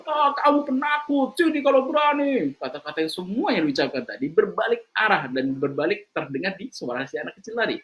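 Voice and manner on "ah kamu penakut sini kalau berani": high, slow, shouted taunt; angry child. Acted. An angry child on the mountain taunts the echo, shouting high and slow. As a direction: taunt the hidden voice like an angry little boy. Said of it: "Maka dia marah-marah".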